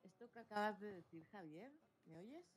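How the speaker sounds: barely audible, far from the mic; very faint audio. Real, not acted. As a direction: voice coming through very faintly. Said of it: "Muy bajito se te oye".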